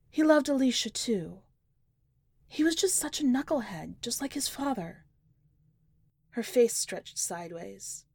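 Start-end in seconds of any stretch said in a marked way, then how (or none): 0.1-5.0 high, pained grieving mother; a mother's voice cracking with grief; grieving mom choking up over her dead son; tearful mother. Acted.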